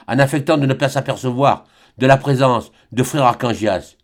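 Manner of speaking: plain reading voice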